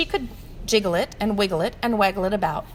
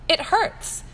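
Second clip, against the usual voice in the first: put-on hurt child; 8 semitones higher than usual. Child in pain.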